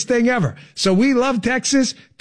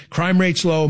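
ordinary talk, nothing marked

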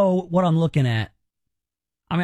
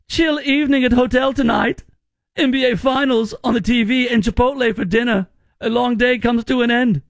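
higher-pitched, hammed-up German accent; mock German accent; 5 semitones higher than usual. Host reading a German visitor's travel post, mock German accent. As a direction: imitate a German tourist, pitched up. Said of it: "you should say in a German accent".

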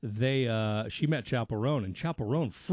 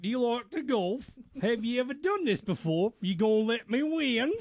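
higher, mock New Zealand accent; 7 semitones higher than usual. Imitating dater at mini golf, New Zealand accent. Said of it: "because of the accent".

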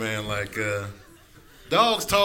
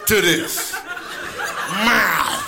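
yelling cat; 5 semitones higher than usual. Acted. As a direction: demand your breakfast like an outraged cat. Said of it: "cats are yelling at you".

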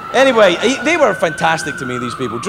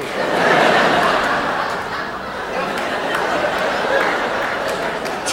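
long breathy laugh; 7 semitones higher, 6 dB quieter than usual. Real, not acted.

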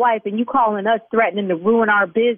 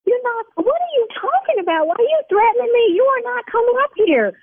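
outraged high shriek; 12 semitones higher than usual. Acted. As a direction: voice jumps way higher, indignant. Shop owner outraged at threat.

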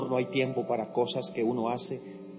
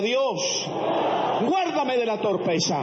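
higher, louder repeat for the crowd; louder call; 9 semitones higher, 3 dB louder, slower than usual. Shown as a demonstration. Preacher asking the congregation to say it louder. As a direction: push it up and out so they join. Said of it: "No los oigo".

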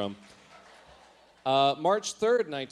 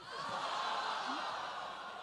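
breathless laugh; 12 semitones higher, 10 dB quieter than usual. Real, not acted. Laughing at own diary.

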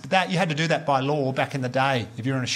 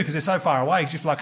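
usual speaking voice

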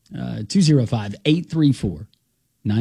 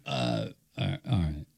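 resigned phone reply; 2 semitones lower, 13 dB quieter than usual. Retold or imitated. Retelling the co-host's couch delivery call, resigned and quiet. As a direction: imitate a deflated, hesitant all right. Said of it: "He just paused and he went".

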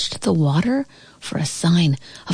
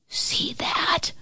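soft, urgent stage whisper; 12 semitones higher, 6 dB quieter than usual. A narrator's reading. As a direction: whisper it, barely voiced. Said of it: "my brother whispered".

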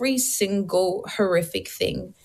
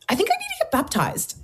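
sudden realization; 11 semitones higher, faster than usual. Retold or imitated.

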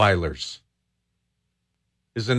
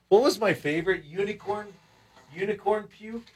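soft, high, questioning; musing recall; 9 semitones higher, 7 dB quieter than usual. Real, not acted. Host searching memory for a firework name, musing softly. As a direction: wonder aloud, hushed and high.

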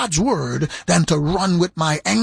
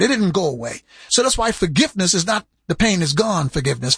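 ordinary delivery

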